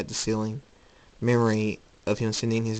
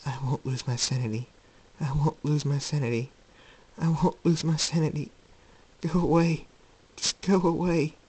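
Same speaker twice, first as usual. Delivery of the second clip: troubled man, pleading; 5 semitones higher than usual. Acted. Jacob fighting the laughter in his head, increasingly desperate. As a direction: build from murmur to strained insistence.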